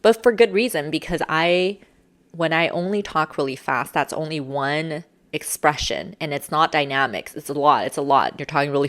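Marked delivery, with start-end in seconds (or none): none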